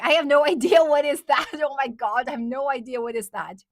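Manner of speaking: ordinary talk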